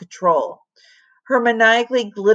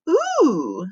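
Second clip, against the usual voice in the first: high eager squeal; 9 semitones higher than usual. A narrator's reading. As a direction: squeal with delight.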